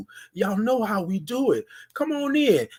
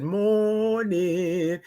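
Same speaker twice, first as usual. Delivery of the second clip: long, slow "Morning"; the way y'all have to say it; drawn-out morning; 3 dB quieter than usual. Shown as a demonstration. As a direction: stretch the morning out. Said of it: "That's how y'all have to say it".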